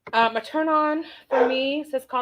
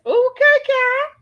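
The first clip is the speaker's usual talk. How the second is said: excited approval; 13 semitones higher, 7 dB louder than usual. Real, not acted. Cheering chat comment.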